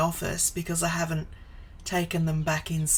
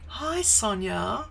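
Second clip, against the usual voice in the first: normal talking voice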